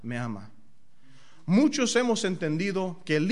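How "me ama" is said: soft, much lower answer; hushed tender me ama. Real, not acted. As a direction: say it like a quiet revelation. Tender answer to own question.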